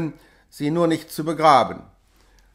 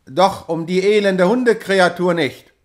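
louder, contemptuous reply; scornful retort; 3 semitones higher, 6 dB louder than usual. A narrator's reading. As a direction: sneer at the fuss over a dog. Rough worker shouts back. (höhnische Antwort)

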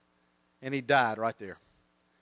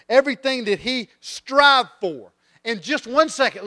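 raised, drawn-out preacher crescendo; impassioned preaching; 6 semitones higher, 5 dB louder than usual. Real, not acted. Preacher driving home a rich king's sudden downfall, impassioned. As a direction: hammer each phrase out slowly and high. Preaches at rising full force.

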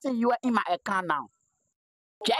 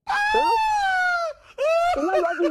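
laughing shout; 4 dB louder than usual. Real, not acted. Laughing challenge.

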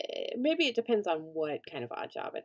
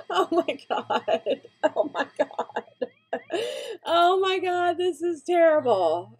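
laughing panic; 10 semitones higher, 8 dB louder, slower than usual. Real, not acted. Aftermath of fire panic.

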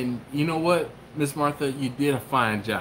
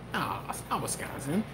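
soft mock dismissal; hushed, doubtful aside; dismissive answer; 9 dB quieter than usual. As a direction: answer yourself quietly, brushing it off. Answering his own high question.

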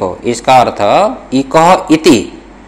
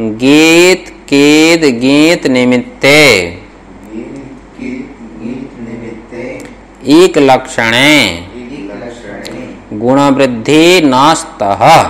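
slow, measured chant-like recitation; sutra recitation; 2 semitones lower, slower than usual. Shown as a demonstration. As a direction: recite in separate phrases for students to repeat. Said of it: "बोलो". (छात्रों से दोहरवाने के लिए सूत्र का धीमा पाठ)

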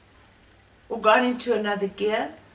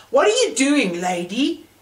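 higher, louder, angry demand; narrated angry outburst, higher and louder; angry question; 4 semitones higher, 5 dB louder than usual. A narrator's reading. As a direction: snap it at her, high and loud. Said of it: "the man asked angrily".

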